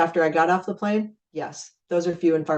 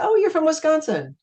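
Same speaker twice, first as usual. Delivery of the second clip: bright friendly surprise; 10 semitones higher than usual. Retold or imitated. Friendly bar chat with stranger.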